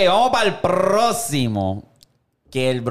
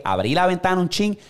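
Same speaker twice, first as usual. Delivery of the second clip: ordinary talk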